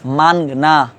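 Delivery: মানগনা with a clear nasal n before the g, man-g-na; teacher modelling মানগনা as man-g-na; careful Urdu pronunciation. Shown as a demonstration. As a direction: say মানগনা slowly, sounding each part. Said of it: "আমার উচ্চারণ খেয়াল করেন".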